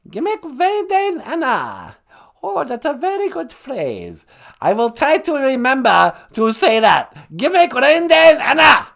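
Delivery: high, loud, hammed-up learner; high-pitched guest character. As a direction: gush in a high, theatrical character voice.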